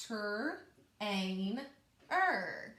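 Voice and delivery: each part apart: ter, ain, er; segmented syllables. Shown as a demonstration. Teacher sounding out parts for pupils, one by one. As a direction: sound out chunk by chunk. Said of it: "let's do that again".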